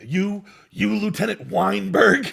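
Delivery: slow, pointed Nicholson line; Nicholson impression. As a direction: drawl out the name like Jack Nicholson. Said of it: "he does the take".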